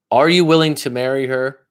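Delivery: ordinary talk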